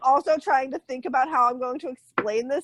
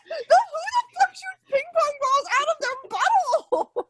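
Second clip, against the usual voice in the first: high, laughing outburst of disbelief; 11 semitones higher than usual. Real, not acted. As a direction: shriek it through laughter.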